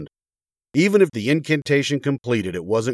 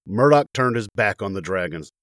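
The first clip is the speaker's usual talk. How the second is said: plain reading voice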